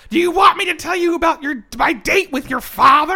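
sing-song teasing mother; mock mom. [name] imitating his mom offering date details, high and loud. Mom voiced in retold call.

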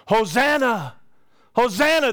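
put-on high, loud joyful cry of children; ringing shout of praise; crying out Hosanna. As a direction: call it out like excited kids. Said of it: "they begin to cry out".

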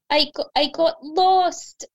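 high, drawn-out little duckling wail; tearful cry. A narrator's reading. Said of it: "cried the little duckling".

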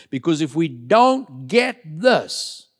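ordinary talk, nothing marked

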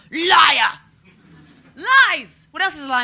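high, loud accusing yell; mock furious shout. Child yelling at her mother, hammed-up furious. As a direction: scream it at your lying mum.

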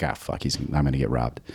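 panicked thought kept to himself; scared inner mutter. Retold or imitated. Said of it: "Now I'm like".

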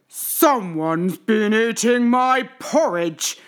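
big, loud, slow bear growl; Papa Bear growling. A narrator's reading. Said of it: "growled Papa Bear".

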